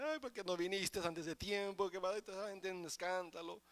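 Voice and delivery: lightly hammed-up tearful complaint; mock wailing. Mourners reproach Jesus for coming late, mock wailing. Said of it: "llega la gente llorando".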